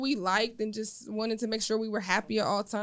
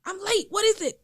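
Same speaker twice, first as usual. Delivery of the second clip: high, louder hurried demand; rushed mom; 10 semitones higher, 5 dB louder than usual. Retold or imitated. Late mom presses her son, high hurried demand. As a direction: hurry him up, impatient. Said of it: "And I was like".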